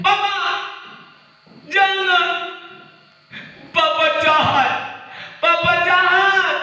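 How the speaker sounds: put-on high, loud wailing child; child wailing at his father; crying child. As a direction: protest like a hurt little kid. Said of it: "Anaknya menangis".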